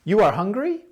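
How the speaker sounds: rising confirmation question; statement order with a checking rise; confirming rise. Shown as a demonstration. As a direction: ask it like you already know. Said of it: "is more like you kinda know".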